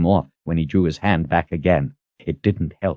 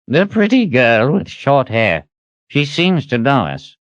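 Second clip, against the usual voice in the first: lightly voiced, higher puzzled character; puzzled wizard; 8 semitones higher than usual. A narrator's reading. Zed shrugging about the girl, mildly puzzled. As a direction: muse aloud in a lifted voice.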